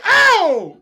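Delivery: high loud yelp of Ow!. Retold or imitated. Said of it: "I did the [name]".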